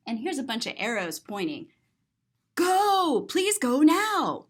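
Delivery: ordinary delivery, nothing marked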